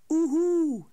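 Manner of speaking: loud high hooting owl call. A narrator's reading. Snow owl keeps hooting outside, loud hoot. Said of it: "Die sneeuwuil kon er maar geen genoeg van krijgen".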